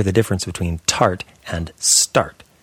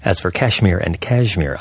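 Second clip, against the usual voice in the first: ordinary delivery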